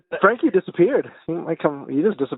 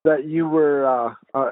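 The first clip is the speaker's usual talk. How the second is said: ordinary talk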